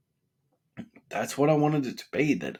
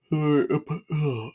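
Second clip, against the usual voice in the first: talking through a yawn; 4 semitones higher than usual. Real, not acted. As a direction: yawn mid-sentence. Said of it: "I'll try and stop yawning".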